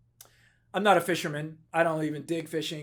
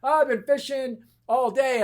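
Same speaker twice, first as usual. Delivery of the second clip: excited friend's higher, far-carrying call; all excited; 7 semitones higher, 4 dB louder than usual. Retold or imitated. Friend back at the dock from a fishing trip, excited. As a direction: imitate a friend thrilled to be back. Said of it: "he was all excited".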